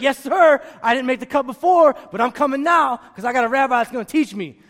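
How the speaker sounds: loud, eager, pumped up; excited disciples. Retold or imitated. Young disciples thrilled Jesus picked them, loud and eager. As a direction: burst out with joy at being chosen. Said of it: "They're like".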